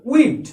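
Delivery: "with" as we'd, one syllable; one-word contraction. Shown as a demonstration. Teacher showing learners we'd, "with" pronounced in one go. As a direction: merge we and would into a single we'd for "with". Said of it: "আমরা একবার উচ্চারণ করব".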